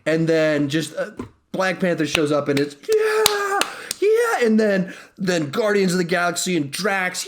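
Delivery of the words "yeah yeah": very high whooping yells of joy; crowd cheering. Retold or imitated. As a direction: cheer like a packed theater erupting. Everyone's just freaking out.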